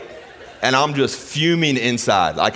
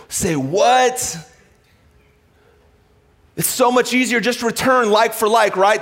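raised, ringing preacher's voice; preaching; 8 semitones higher than usual. Acted. Pastor preaching to the congregation, raised and fervent. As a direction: preach it out to the church.

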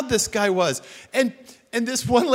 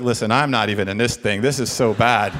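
a hint of Satan, low and weary; low grumbling devil, understated; lightly voiced Satan; 10 semitones lower than usual. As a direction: play Satan as fed up, lightly. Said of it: "I felt like Satan was like".

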